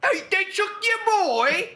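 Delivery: high, slow mock gangster taunt with a laugh; mocking the gangster, high bad British accent. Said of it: "bad accents".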